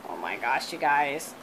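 very low, soft amazed murmur; hushed awe. Real, not acted. Crafter marveling to viewers at blue vinyl, hushed. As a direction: drop right down, almost speechless.

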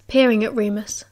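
ordinary delivery

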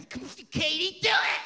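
high, loud hammed-up urging. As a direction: cheer her on like a frat boy.

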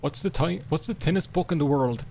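ordinary talk, nothing marked

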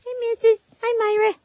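high bright hello; cooing greeting. Real, not acted. Owner greeting her animals, high cooing hello. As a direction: greet them like babies.

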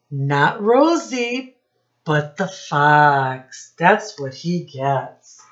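ordinary delivery

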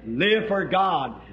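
ordinary talk, nothing marked

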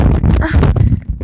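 high breathy Southern-belle titter; shy parlor laugh. Acted. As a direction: laugh it off politely, high and airy. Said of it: "a shy parlor laugh like a Tennessee Williams character".

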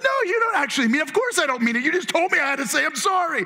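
high-pitched, indignant back-and-forth; mock protesting kid. Parent and kid arguing over a forced apology, mock protest.

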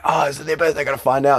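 high, loud mock panic; flustered realization. Coach reliving a forgotten birthday, high flustered alarm. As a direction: blurt it as a sudden worried realization.

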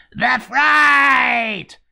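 high, loud yelled title; a goblin guard screaming his own title, loud and high; shouting. Retold or imitated. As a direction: bellow it like a mad goblin leaping about. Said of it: "began to leap around shouting".

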